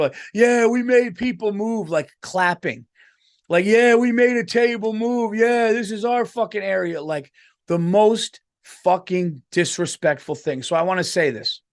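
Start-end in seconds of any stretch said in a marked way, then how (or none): 0.3-1.9 mocking a smug, high, loud gloat; gloating adults. Rowdy adults bragging they drove a family out, mock gloat. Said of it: "adults at that fucking table". 3.6-7.0 mocking a smug, high, loud gloat; gloating adults. Rowdy adults bragging they drove a family out, mock gloat. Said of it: "adults at that fucking table".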